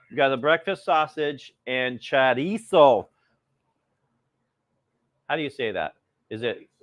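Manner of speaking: ordinary talk, nothing marked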